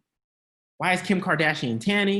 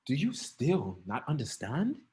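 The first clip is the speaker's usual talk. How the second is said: soft, slow question; mock meek; 2 semitones lower, 6 dB quieter, slower than usual. Shown as a demonstration. Preacher mocking a meek Jesus, soft and slow. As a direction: murmur it timidly. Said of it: "that's not how he said it".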